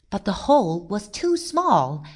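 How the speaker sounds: usual speaking voice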